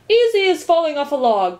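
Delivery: high, loud, breezy exclamation; crying out. A narrator's reading. Earl agrees with Milo, crying out. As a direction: call it out emphatically. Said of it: "cried the earl".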